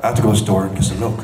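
a hint of the brooding archer, rushed; Oliver Queen impression. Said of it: "This is Oliver Queen doing".

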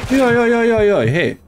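high, rapid alarmed jaj jaj jaj; frantic startled cries; panicked yelps. Real, not acted. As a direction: yelp in real panic as enemies hit. Panic under attack.

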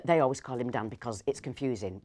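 normal talking voice

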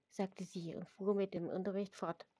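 ordinary delivery

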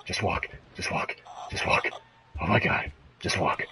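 hushed frightened whisper; scared whisper. Real, not acted. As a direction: whisper to yourself to keep moving. Sneaking past danger, scared.

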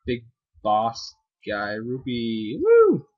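normal talking voice

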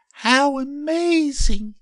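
very high, loud, slow squeal of wonder; drawn-out high-pitched delight; amazed exclamation. A narrator's reading. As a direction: marvel as a tiny plant. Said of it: "she exclaimed".

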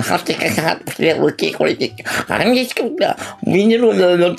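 a hint of a drunk ranter; imitating Yogima. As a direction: mimic someone rambling nonsense. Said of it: "とか言ってんだよ".

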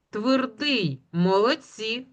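usual speaking voice

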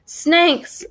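high, restrained yell of alarm; narrator's mild yell; warning yell. A narrator's reading. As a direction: call out a warning, pitch jumping up. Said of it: "one of the K-Bones yells".

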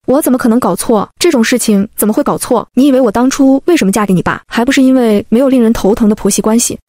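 plain reading voice

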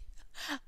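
short breathy giggle; real quiet giggle; breathy laugh. Real, not acted. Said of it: "My voice is high pitch".

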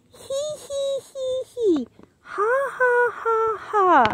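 squeaky soft giggling laugh; high giggle. Acted. Book giggling again at the reader. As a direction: titter like a tiny creature.

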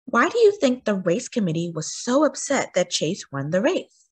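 plain reading voice

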